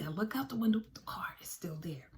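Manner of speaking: breathy hushed storytelling whisper, dropping away; tense whisper. Real, not acted. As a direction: whisper as if the car might hear you. Checking window again, tense.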